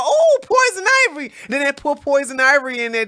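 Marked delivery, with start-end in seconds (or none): 0.0-1.3 very high, louder cooing ooh; mock delight. Imitating centrists thrilled to find poison ivy, mock delight. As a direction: squeal it like a wonderful discovery. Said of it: "they'll go".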